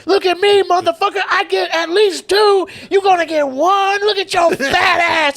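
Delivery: imitated high-pitched yelling, words stretched out; loud roasting. Counter woman pushes a big customer to get two, loud roasting. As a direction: berate him at the top of your voice. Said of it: "like, really loud just goes".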